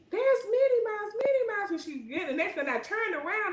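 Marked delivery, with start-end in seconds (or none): 0.1-1.7 put-on high, excited little girl voice; much higher, eager child pointing something out. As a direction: play the little girl tugging at her mom. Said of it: "And I'm like".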